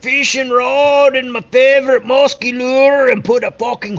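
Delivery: high, strained maniac voice; crazed killer voice. Retold or imitated. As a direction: go full unhinged killer. Acting out killer's thought.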